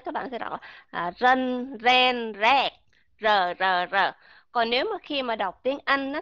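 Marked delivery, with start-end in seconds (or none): 0.9-4.1 rân, ren, rẹt with the r said as a flat Vietnamese r, mouth open; Vietnamese r reading. Shown as a demonstration. Teacher showing students the Vietnamese r in rân, ren, rẹt. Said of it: "đọc theo âm R của tiếng Việt Nam".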